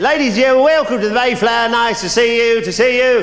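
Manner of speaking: high and loud, beaming host catchphrase; game show host greeting. Pretend. Comic greeting the audience as a game show host. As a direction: belt it out like a telly quiz host.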